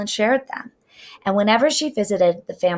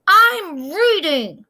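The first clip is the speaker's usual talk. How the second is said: put-on high, slow little girl voice; defensive child; 11 semitones higher, 5 dB louder, much slower than usual. A narrator's reading. Trisha answering the teasing kids, high and slow. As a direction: insist like a hurt small child.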